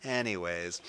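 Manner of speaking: normal talking voice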